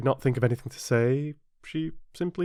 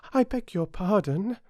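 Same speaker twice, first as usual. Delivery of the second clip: higher-pitched meek apology; very humble; 11 semitones higher than usual. A narrator's reading. Alice apologising to the offended mouse, very humbly. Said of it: "very humbly".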